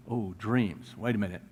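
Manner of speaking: low, hushed musing to himself; murmured as a memory surfaces; acted dawning realization. Cupbearer suddenly remembers, acted by preacher.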